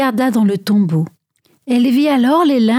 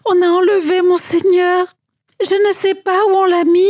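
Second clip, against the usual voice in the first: sobbing; 6 semitones higher than usual. A narrator's reading. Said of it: "Et Marie sanglotta".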